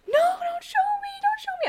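high squealing plea, imitating a scared teen; mock-panicked high girl voice. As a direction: squeak it out like a frightened kid. Scared girl refuses, retold.

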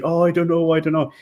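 put-on indecisive voice; mock hesitation. Mimics hesitant would-be buyers.

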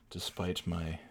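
low, very soft sign-off; subdued aside. Real, not acted. Podcaster admitting his insanity after ranting, subdued. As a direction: let it drop away, worn out. Muttered self-aware closing.

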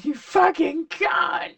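high, drawn-out imitation of a shout; mock scream. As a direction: stretch it out in a hammed-up yell. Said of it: "I screamed it so loud".

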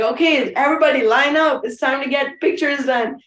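mock take-charge announcement; bossy coordinator. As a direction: imitate a coordinator rounding up guests. Acting out herself marshalling wedding guests.

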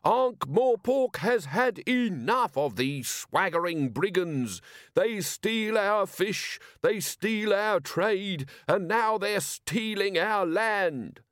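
high, drawn-out soapbox oratory; screamed harangue. A narrator's reading. Monomaniac speaker rants at the square, screaming. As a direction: rouse the crowd with slow, high, swooping cries. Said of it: "screamed the nearest one".

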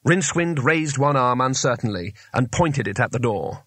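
usual speaking voice

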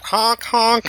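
high honking clown horn; silly clown. Pretend. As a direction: honk like a tiny clown car. Shriners driving off honking.

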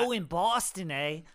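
mock Boston accent, loud and bright; playful Boston accent. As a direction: put on a Boston twang for fun. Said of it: "that was a great Boston accent".

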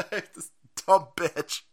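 ordinary talk, nothing marked

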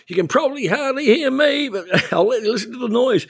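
high, loud call over a roaring crowd; retold yelling over noise. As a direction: shout it like you're fighting a roar to be heard. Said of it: "at one stage I'm yelling".

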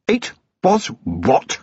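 slow, high, incredulous question; disbelieving, pitch jumping up; appalled disbelief. A narrator's reading. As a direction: draw out each word in outrage. Appalled at the oath.

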